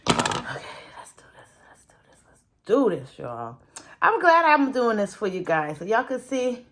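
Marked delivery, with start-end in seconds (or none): 0.1-2.4 whispered self-encouragement. Real, not acted. Creator to self before a hard job, whispering. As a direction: breath-only whisper. Psyching self up under breath.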